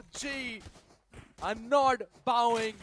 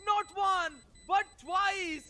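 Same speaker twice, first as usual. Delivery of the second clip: excited shout; 6 semitones higher, 7 dB louder, slower than usual. Real, not acted. Caster calls clutch win.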